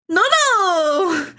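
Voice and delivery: mock delighted cry; imitating Parasi. Imitating Parasi seeing him in chat, high laughing shout. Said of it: "That's how she does it".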